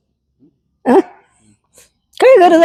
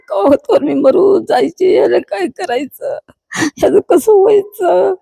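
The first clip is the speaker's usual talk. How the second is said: slow, higher voice breaking into a sob; crying; 5 semitones higher, slower than usual. Real, not acted. Old woman fearing for her daughter's future, slowly breaking into sobs. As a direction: let it crack into crying. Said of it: "तुम्ही रडू नका आजी".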